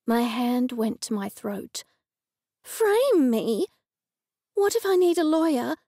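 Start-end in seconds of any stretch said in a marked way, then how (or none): 2.6-5.8 high-pitched alarmed questions. A narrator's reading. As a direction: ask in rising alarm, voice lifted high. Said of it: "I asked in alarm".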